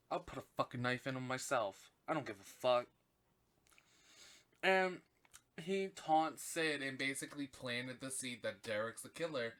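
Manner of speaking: ordinary talk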